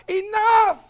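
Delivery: a high, loud shouted Enough. Retold or imitated. Preacher voicing the Lord's shout to the congregation, loud and high. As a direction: throw Enough out with full force. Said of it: "He's going to shout".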